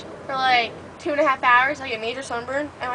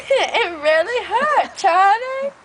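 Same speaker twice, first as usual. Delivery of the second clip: put-on high, slow whiny child voice; mock-crying little boy; imitating a crying toddler; 7 semitones higher, 5 dB louder, much slower than usual. Quoting Charlie bit me video.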